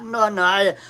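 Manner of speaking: ordinary delivery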